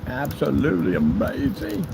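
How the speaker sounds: ABSOLUTELY AMAZING stretched out slowly; drawn-out repeat. Pretend. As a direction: savour "absolutely amazing", syllable by syllable.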